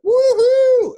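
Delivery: very high, loud whooping cheer; exhilarated scream. Retold or imitated. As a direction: holler it like you're soaring through the sky. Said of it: "like, I'm screaming".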